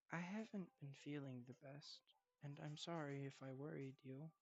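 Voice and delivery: soft, subdued apology; unwell grandson. Acted. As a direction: apologise quietly to your grandfather while feeling poorly.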